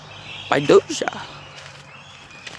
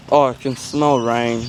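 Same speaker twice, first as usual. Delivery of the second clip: usual speaking voice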